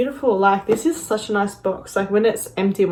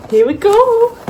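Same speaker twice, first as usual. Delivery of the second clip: excited; 12 semitones higher, 9 dB louder than usual. Real, not acted. Excited start of unboxing.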